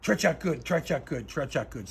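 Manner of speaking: a mild mock Russian nodding in approval; imitating the calmed officer, fading to normal; approving Russian officer. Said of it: "he goes".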